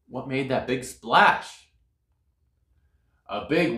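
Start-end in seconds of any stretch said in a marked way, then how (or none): none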